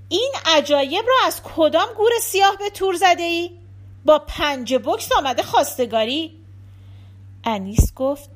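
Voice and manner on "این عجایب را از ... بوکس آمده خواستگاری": loud, raised-pitch incredulous scolding; scolding shout. Acted. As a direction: yell at her in outraged questioning. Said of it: "بتول خانم داد زد".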